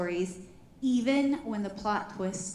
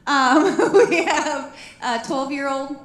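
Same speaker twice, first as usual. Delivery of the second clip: laughing; 3 semitones higher, 9 dB louder, slower than usual. Real, not acted. Laughing through introduction.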